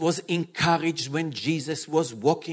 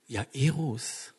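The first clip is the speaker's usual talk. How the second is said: calm, loving voice of Jesus speaking to his bride; gentle reassuring Jesus. Acted. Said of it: "what the king told Jairus?".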